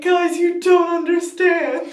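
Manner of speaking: loud, high, drawn-out exasperated plea; frantic fan plea. Retold or imitated. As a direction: plead loudly, stretching every word. Frantic plea to calm crowd.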